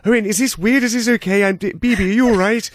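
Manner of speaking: high, gentle, hesitant interviewer voice; Louis Theroux impression. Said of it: "Louis Theroux chatting to".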